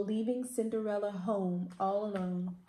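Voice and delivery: plain reading voice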